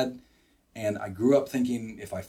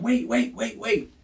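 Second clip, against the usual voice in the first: higher-pitched mock plea to stop; panicked plea; 10 semitones higher, slower than usual. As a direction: act out begging a sparring partner to hold off. Pleading again under retold punches.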